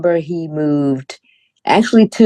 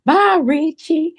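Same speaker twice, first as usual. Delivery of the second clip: put-on high, slow little-girl goodbye; child voice; 11 semitones higher, slower than usual. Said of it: "I would say".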